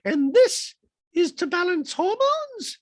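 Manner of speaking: high, loud falsetto question; slow, high mock housewife voice; Dorit impression voice. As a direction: put on a shrill-pitched falsetto Dorit.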